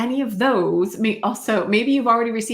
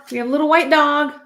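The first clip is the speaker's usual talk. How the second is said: excited; 6 semitones higher, 5 dB louder, faster than usual. Real, not acted. Tarot reader spotting a dog on the card, excited. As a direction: blurt it out as you spot it.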